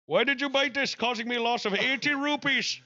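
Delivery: high, mock Indian accent scolding a mouse; imitating Indian man. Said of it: "quote repeated".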